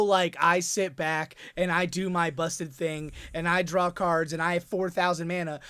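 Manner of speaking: normal talking voice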